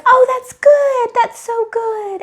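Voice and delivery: high, slow and sugary; sweet cheerful friend. Retold or imitated. Friend brushing off her answer, sweet high voice. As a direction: mimic a breezy, pleased acquaintance.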